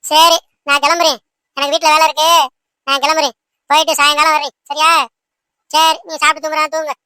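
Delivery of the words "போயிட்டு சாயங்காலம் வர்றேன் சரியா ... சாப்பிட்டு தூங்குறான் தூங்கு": ordinary talk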